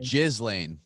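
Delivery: Ghislaine said as "JIZ-lane"; joke mispronunciation. Shown as a demonstration. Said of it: "I thought it was Ghislaine".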